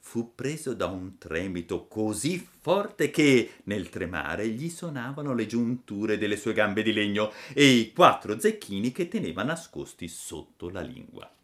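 ordinary delivery

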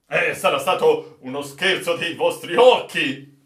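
loud, brushing-off reply; loud sceptical protest; dismissive retort. Acted. Sailor dismisses officer's vision.

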